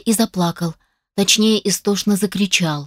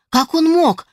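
high, loud disbelief on мог; shocked outrage; 9 semitones higher, 4 dB louder, slower than usual. Acted. Father hears of the DNA test, shocked high outcry. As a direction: cry it out, appalled.

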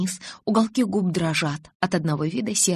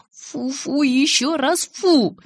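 high, drawn-out yuck; exaggerated revulsion, pitched up; disgusted; 8 semitones higher, 4 dB louder, much slower than usual. A narrator's reading. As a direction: spit out each refusal slowly. Bella disgusted at wedding vows.

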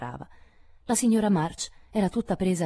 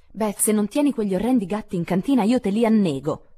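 loud, high, furious snap at her sister; furious outburst; 7 semitones higher, 7 dB louder than usual. Acted. Meg erupts at Beth over the scratching kitten, furious and loud. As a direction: explode at her like she's had enough. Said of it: "sbottò furiosa Meg".

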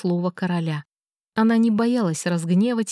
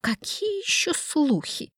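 displeased king; 8 semitones higher, 4 dB quieter, slower than usual. A narrator's reading. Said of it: "Его недовольство было почти ощутимым".

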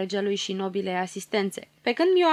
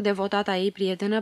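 ordinary delivery, nothing marked

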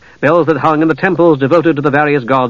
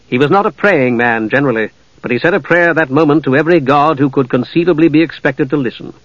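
usual speaking voice

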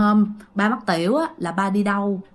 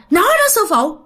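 higher-pitched retold complaint; possessed father answering; 7 semitones higher than usual. Possessed father answering ông Tám, acted aggrieved reply. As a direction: plead your case in a raised pitch. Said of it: "Thì lúc đó ba mới trả lời".

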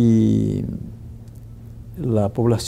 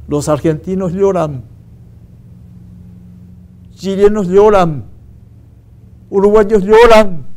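rising, louder, slowing toward the end, a mock lament; retold weeping grandmother; 5 semitones higher, 8 dB louder than usual. Teacher retells the grandmother's grief with a mounting tearful lament. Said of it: "y llora ella".

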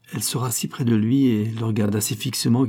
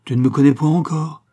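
hissed warning bursting loud at the end; brewing storm; 6 semitones higher, 5 dB louder than usual. A narrator's reading. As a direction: let the threat swell from a hiss to a cry. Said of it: "dont la voix couvait les tempêtes".

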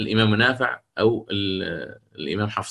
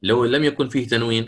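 usual speaking voice

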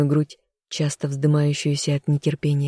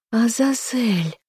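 slow gasped name, much higher; gasp of surprise; 8 semitones higher, slower than usual. A narrator's reading. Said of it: "ахнула она".

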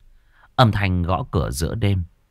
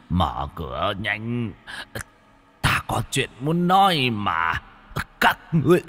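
put-on exhausted old man; 2 semitones higher than usual. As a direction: plead weakly through the door. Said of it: "giọng nói của lão nhân dường như đã kiệt sức".

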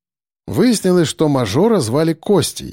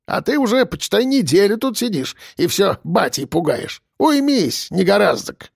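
put-on higher-pitched old man scolding; mocking old-timer voice; old grandad; 7 semitones higher than usual. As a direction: tick off a youngster like a scoffing grandad. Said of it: "Расхохотался дед Ефим, кашлянув при этом".

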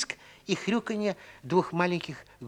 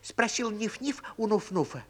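usual speaking voice

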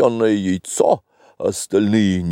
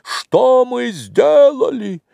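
high, drawn-out indignant cry; крик в окно; 12 semitones higher, 3 dB louder, much slower than usual. A narrator's reading. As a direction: call out the window in outrage. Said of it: "отворили окно и крикнули". (высокий протяжный возмущённый крик)

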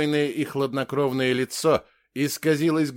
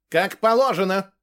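higher, louder sharp interjection; sharp retort; 7 semitones higher, 6 dB louder than usual. A narrator's reading. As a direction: cut in sharply and loudly. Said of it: "прозвучал резкий голос". (резкий ответ)

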